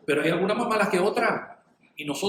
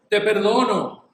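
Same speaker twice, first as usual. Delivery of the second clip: loud, higher declaration of forgiveness; acted declaration; 4 semitones higher, 7 dB louder, slower than usual. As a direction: proclaim it firmly and out loud. Acting out forgiving someone aloud.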